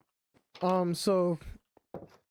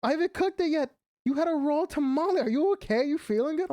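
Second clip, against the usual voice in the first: high, fussing concern; worried mom; 9 semitones higher than usual. Retold or imitated. Mom worried her son ate raw dough, high fussing concern. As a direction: raise the pitch and check on him. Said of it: "she's like".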